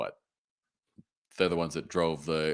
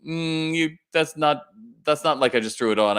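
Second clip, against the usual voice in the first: playful teasing; 8 semitones higher, 4 dB louder than usual. Retold or imitated. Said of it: "I go".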